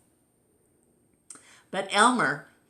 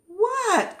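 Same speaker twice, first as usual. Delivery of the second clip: very high, louder what; eager cry; 5 dB louder than usual. Acted. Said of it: "cried the others".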